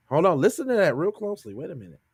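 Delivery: normal talking voice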